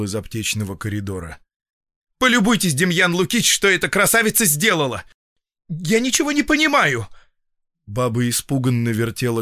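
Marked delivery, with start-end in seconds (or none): 2.2-7.1 high, loud exasperated bafflement; agitated outcry. A narrator's reading. Doctor showing feldsher what patient did, agitated. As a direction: appeal to him in high-pitched dismay.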